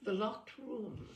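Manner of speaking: ordinary talk, nothing marked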